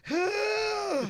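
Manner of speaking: high, held "huh"; mock dread gasp. Comic learns he'll work with George, high mock-alarmed huh. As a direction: let out a long high huh.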